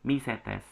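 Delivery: micetes with the C said as S; correct S reading. Shown as a demonstration. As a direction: say micetes as "mi-SE-tes". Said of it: "seperti dibaca dengan S".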